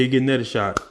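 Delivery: ordinary talk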